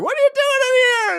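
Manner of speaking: very high, loud squawk; mock outraged screech. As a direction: screech it at an intruder, hammed-up. Acted shriek of brawling prostitutes.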